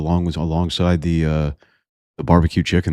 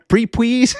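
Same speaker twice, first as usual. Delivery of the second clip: high squeaky laughing; laughing mimic. Real, not acted. Reading a viewer's message, laughing in a high squeak. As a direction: laugh through the whiny beg. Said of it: "laughs while mimicking".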